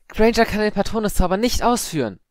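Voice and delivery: put-on high, loud indignant girl; indignant protest in a girl's voice; agitated cry. A narrator's reading. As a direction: burst out high-pitched and upset. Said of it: "rief Padma aufgebracht".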